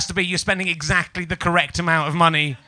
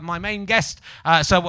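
ordinary talk

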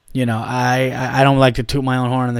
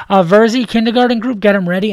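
calling out; 7 semitones higher, 5 dB louder than usual. Retold or imitated. Staff calling over walkie-talkie.

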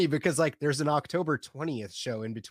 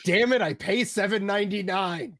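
louder, higher fake complaint; mock outrage; 7 semitones higher, 5 dB louder than usual. Cohost jokes about only paying for two shows, mock outrage. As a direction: playfully grumble about the price.

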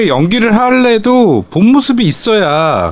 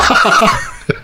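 breathy laugh; 6 semitones higher than usual. Real, not acted. Laughing at the teasing.

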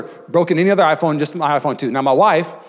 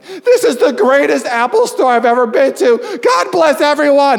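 much higher, slow, laughing delight; giddy joy; 11 semitones higher, 5 dB louder than usual. Retold or imitated. Walking out of the Apple store, giddy and laughing. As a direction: gush it out, beaming with disbelief.

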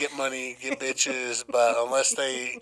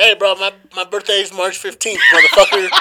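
mock yelling; 4 semitones higher, 8 dB louder than usual. Mock demand for birthday gift.

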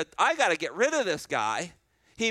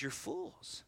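a breathy whisper, much softer; whispered rebuke; 5 semitones lower, 15 dB quieter than usual. Acted. Preacher quoting Paul calling the doubters fools, whispered. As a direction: drop to a whisper for Paul's rebuke.

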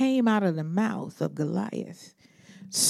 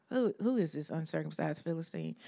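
softer, fast, scornful mutter; 2 semitones lower, 12 dB quieter, faster than usual. Retold or imitated. David scoffing at the men in today's words, muttered. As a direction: toss it off under your breath. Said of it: "David is standing there like".

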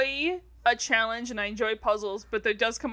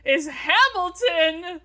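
slow dramatic reveal; 5 semitones higher, slower than usual. Real, not acted.

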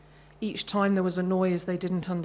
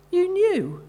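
surprised; 10 semitones higher, 5 dB louder than usual. A narrator's reading. Dad surprised at discovery.

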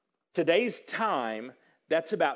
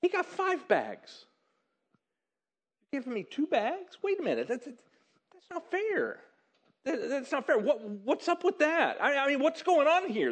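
high-pitched, mock-aggrieved complaint; indignant protest; 6 semitones higher than usual. Short-changed employee objecting to the boss's split, indignant. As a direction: act out a whiny it's-unfair objection.

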